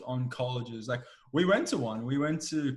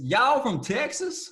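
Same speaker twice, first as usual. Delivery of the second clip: high, slow, louder Texan twang; Texan drawl; 11 semitones higher, 6 dB louder, slower than usual. Retold or imitated. Host mocks how Texans talk in a hammed-up, high, slow Texan drawl. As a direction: ham up a Simpsons-style Texan. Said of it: "is that how they talk?".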